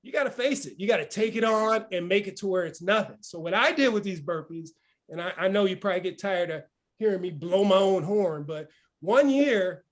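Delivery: ordinary delivery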